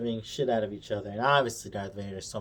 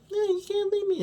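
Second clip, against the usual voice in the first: high mock girl voice begging; little girl pleading. Leia begging Obi-Wan to stay, high mock pleading. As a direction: squeak it like a clingy kid.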